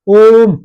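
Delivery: ओम stretched long and loud; ओम को लंबा खींचें; drawn-out Om. Shown as a demonstration. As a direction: pull ओम out long like a chant. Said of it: "लंबा खींच रहा हूं ना".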